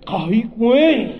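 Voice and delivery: high, loud, slow stunned groan; drawn out in disbelief; hammed-up amazement; mock awe. Reacting to huge member.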